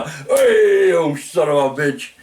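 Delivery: loud high "hey" falling into a curse; mock-angry holler, loud at the start; retold angry yell. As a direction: re-enact hollering an insult after someone. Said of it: "like that to him".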